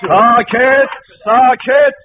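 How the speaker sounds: bang the gavel with your voice; stern call for silence. Acted. Judge hushing the noisy court, loud stern order.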